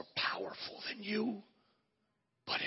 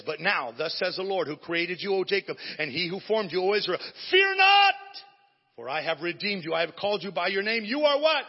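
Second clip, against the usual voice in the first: proclaiming Isaiah louder and louder; scripture read aloud; 3 semitones higher, 11 dB louder than usual. Acted. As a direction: build the verses to a cry at "fear not". Reads Isaiah 43 with rising force.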